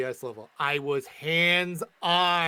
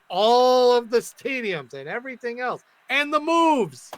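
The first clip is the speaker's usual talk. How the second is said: excited; 7 semitones higher, 4 dB louder than usual. Real, not acted. Heated rant about unchanged models.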